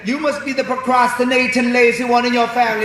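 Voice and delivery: high, teasing Jamaican dad; hammed-up Jamaican father voice; Jamaican accent. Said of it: "Mister Winston was Jamaican".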